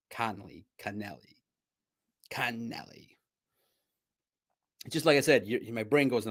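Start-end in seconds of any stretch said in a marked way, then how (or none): 0.1-1.4 very soft, low careful pronunciation; humble. Shown as a demonstration. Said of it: "humbly, "This is how you say it". 2.3-3.2 whispered, careful "Connelly"; humble. Shown as a demonstration. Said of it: "humbly, "This is how you say it".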